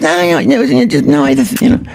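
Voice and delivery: very high squeaky mock Leno; high hammy Leno shrugging off a fall; Jay Leno impression. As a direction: play Leno shrugging it all off. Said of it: "That's a horrible Jay Leno".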